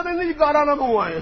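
high, loud mock panic; mock panicked refusal. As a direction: ape a frightened refusal. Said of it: "کہتا".

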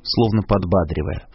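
ordinary delivery

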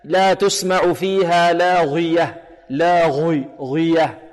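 لاغية with the ghi thickened toward "ghwi"; slow recitation, ghayn of لاغية far too emphatic; over-heavy ghayn. Retold or imitated. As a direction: imitate a student overdoing the heaviness in لاغية. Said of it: "يقول هكذا".

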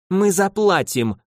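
a wife who won't be argued with; clanging certainty. A narrator's reading. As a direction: clang it shut like a verdict. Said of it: "лязгнул уверенный голос".

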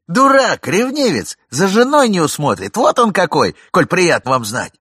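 loud scornful name-calling; loud insults. Acted. Young man mocks the jealous husband loudly, scornful. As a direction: hurl the insults at him.

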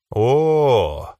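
one long, drawn-out "oh"; drawn-out exclamation. A narrator's reading. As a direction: stretch the vowel out like an impressed dragon. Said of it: "протянул дракон". (протяжное «о»)

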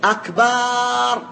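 أكبر as a slow "akbaaar"; lengthened final vowel. Shown as a demonstration. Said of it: "أو قال".

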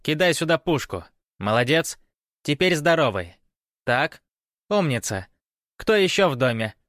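high-pitched, brisk commanding woman; bossy woman's voice. A narrator's reading. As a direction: fire off orders at captives. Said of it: "распорядился женский голос". (властный женский голос, высоко)

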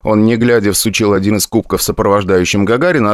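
ordinary delivery, nothing marked

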